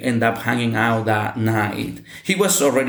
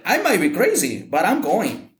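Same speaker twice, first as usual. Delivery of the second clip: smiling, higher, defiant retort; smiling and pitched up, stubborn but light; said with a smile; 9 semitones higher than usual. Retold or imitated. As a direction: grin while you insist you're going. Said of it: "with a smile on my face".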